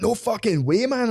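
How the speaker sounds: high, excited mock yell; mock excited cinemagoer. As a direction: shout it like a hyped fan.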